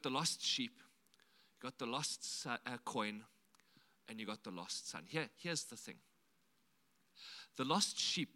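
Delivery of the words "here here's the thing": ordinary talk, nothing marked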